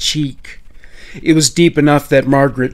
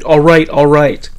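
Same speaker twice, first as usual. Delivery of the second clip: raised-voice, hurried giving in; shouted surrender; 2 semitones higher, 5 dB louder than usual. A narrator's reading. Margaret tries to calm her knife-wielding kidnapper, shouting. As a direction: call out to defuse it, louder. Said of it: "Margaret shouted".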